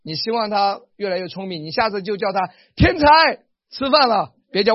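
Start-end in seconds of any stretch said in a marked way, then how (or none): 2.8-4.3 high, loud call across the house. Shown as a demonstration. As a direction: call the kid to dinner. Calling child to dinner.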